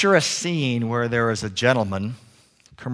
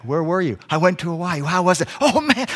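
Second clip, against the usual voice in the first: higher-pitched, animated back-and-forth; acted excited chat; 6 semitones higher than usual. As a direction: play up a thrilled holiday recap. Acted chat about a Hawaii trip.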